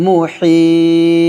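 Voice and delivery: very slow মুহিত, long ই, bounced ত; long vowel and qalqala. Shown as a demonstration. Teacher telling students to say it like this, slow with qalqala. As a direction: stretch মুহিত and echo the ত. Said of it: "এভাবে আপনারা উচ্চারণ করবেন".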